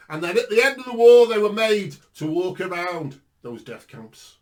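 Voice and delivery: rising preacher's voice, hammering the guilt; preaching. Acted. Street preacher denouncing abortion to the crowd, preaching hard. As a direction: preach it like an indictment from the pulpit. Preaching about the death camps.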